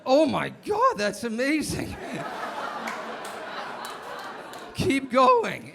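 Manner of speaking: put-on a boy thrilled out of his mind; teen boy reacting to the girl, mock-ecstatic. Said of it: "And I was like".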